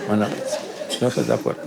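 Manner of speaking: whispered sulky protest under the breath; inner grumble. Pretend. Preacher voicing a sulking believer's inner protest, whispered. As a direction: whisper it like a nodding dashboard doll grumbling inside. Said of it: "pero adentro".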